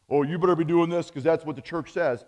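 fast, loud, nagging voice of authority; mock bossy church. Preacher mimicking the state church, fast and hammed-up scolding. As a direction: rattle it off like a pushy official.

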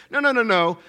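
normal talking voice

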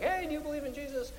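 quick, high, soft approach to a stranger; light, hurried mock greeting; mock evangelist. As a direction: imitate an eager doorstep pitch. Mimics approaching people about faith.

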